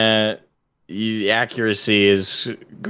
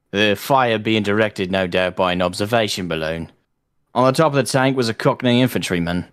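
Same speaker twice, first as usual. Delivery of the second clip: put-on Cockney-style British accent reading. Streamer reading a Cockney war story to friends, British accent. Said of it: "I can do an accent".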